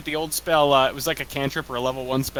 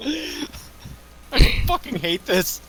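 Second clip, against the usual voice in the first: laughing groan; 10 semitones higher, much slower than usual. Real, not acted. Laughing at the joke.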